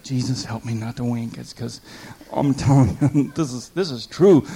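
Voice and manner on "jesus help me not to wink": low, soft mock prayer under the breath; mock hushed prayer. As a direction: pray quietly so nobody hears. Mock prayer of women resisting winking.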